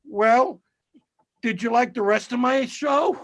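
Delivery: high-pitched, hopeful retold question; insecure comedian. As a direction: play a needy comic fishing for approval. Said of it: "comedians are so insecure".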